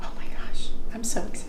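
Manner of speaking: excited hushed whisper. Real, not acted. Excited whisper before tasting.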